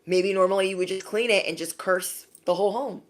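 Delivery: normal talking voice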